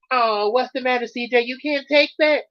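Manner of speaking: ordinary talk, nothing marked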